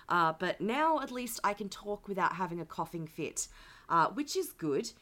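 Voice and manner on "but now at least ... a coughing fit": ordinary talk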